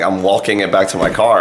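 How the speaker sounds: usual speaking voice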